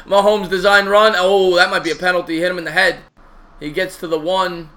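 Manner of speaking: loud, energetic sports commentary; play-by-play call. Acted.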